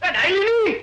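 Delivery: name hollered with falling end; loud calling out. Acted. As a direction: call out to wake the wife. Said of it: "અરે પણ ધીમે બોલો".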